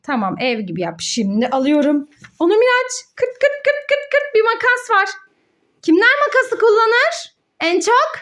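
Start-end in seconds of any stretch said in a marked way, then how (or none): 3.2-4.9 high playful snipping sound for the scissors; cheerful kırt kırt noise for a child; scissor snips. Acted. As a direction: snip it out like scissors cutting. Mother imitates scissors snipping.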